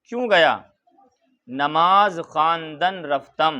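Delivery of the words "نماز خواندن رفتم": slow recitation. Shown as a demonstration. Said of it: "teacher reads Persian line slowly".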